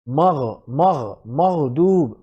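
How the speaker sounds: slow মাগদুব with a voiced গইন; slow recitation. Shown as a demonstration. Teacher models মাগদুব slowly for learners. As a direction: draw out মাগ, rasping the gh. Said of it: "যেমন ধরুন".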